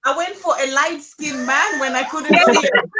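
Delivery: loud, high laughing talk. Real, not acted. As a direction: laugh through the confession. Joking about her light-skinned husband.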